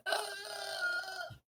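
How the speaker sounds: long, high, thin wheeze of no air; strained airless gasp. Retold or imitated. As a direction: imitate trying to breathe and getting nothing. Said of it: "I was literally going".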